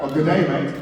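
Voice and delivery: put-on loud Australian-accent greeting; mock Aussie accent. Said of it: "I do every accent".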